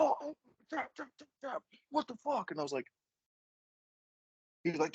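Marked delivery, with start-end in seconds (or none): 0.0-2.5 very high-pitched imitated yelps; mock panicked yelping. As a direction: mimic a man in a painful hold, squealing. Opponent yelping in the ankle lock.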